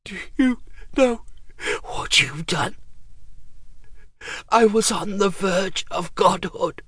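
hoarse, high, strained whisper; raspy whisper. A narrator's reading. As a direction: rasp it out, barely voiced. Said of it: "In a hoarse whisper".

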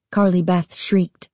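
ordinary delivery, nothing marked

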